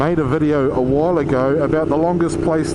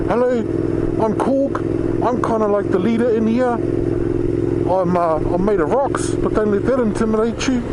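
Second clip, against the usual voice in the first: light New Zealand Korg voice, a little higher; Kiwi accent as Korg; 5 semitones higher than usual. Retold or imitated. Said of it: "with the accent of Taika Waititi".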